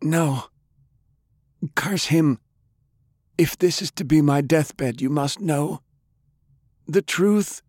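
high, halting deathbed voice; dying old man. A narrator's reading. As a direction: force out a last confession in pieces. Dying grandfather begins deathbed confession.

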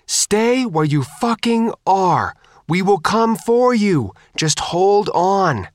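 high, slow, drawn-out calling; loudhailer call. A narrator's reading. Faith hails stranded survivors over the loudhailer, calling out. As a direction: call across the water, stretching every word. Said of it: "picked up the mic for the loudhailer".

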